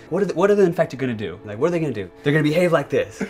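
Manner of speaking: loud, animated retold questions; excited retelling. Developer recalling team guessing about the Infected, excited.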